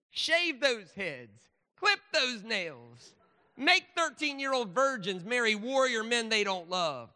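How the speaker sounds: high, loud, slow Southern pulpit bellow; mock fiery preacher. Speaker mocks scripture as a revival sermon, loud slow preaching. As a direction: stretch each command out like a revival preacher. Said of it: "Preach it, preacher".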